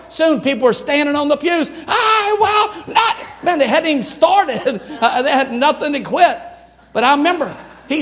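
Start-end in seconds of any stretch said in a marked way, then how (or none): 1.9-3.2 high, slow full shout; crowd shouting. Retold or imitated. Chapel crowd standing on pews, shouting. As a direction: yell it like a room on its feet. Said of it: "it got louder and louder".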